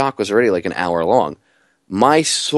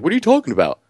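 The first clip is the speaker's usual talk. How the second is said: clueless questioner; 7 semitones higher than usual. Retold or imitated. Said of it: "they're like".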